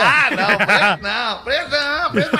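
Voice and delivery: laughing refusal. Real, not acted.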